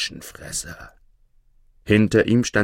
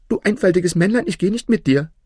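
high-pitched tailor voice; scornful tailor; 11 semitones higher, faster than usual. A narrator's reading. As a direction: brush him off in a high tailor's voice. Schneider weist das Männlein ab. (abweisender Schneider mit hoher Stimme)

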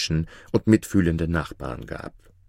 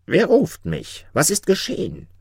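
calling jinni; 12 semitones higher than usual. A narrator's reading. The frightful jinni demands who woke him, calling out high. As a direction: call it out high, as a roused spirit. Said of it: "rief er".

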